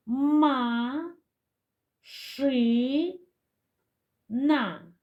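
syllable by syllable, long pauses between; ма... ши... на, each syllable held and set apart; split into syllables. Shown as a demonstration. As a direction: say Ма, ши, на as three separate, drawn-out beats. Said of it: "Поділимо слово на склади".

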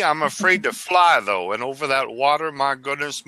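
ordinary talk, nothing marked

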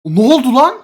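sudden loud startled yelp. Real, not acted. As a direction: jump and blurt it out. Startled in horror game.

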